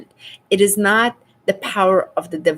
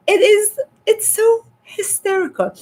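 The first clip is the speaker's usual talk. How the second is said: incredulous outburst; 11 semitones higher than usual. Real, not acted. Incredulous reaction to accent mockery.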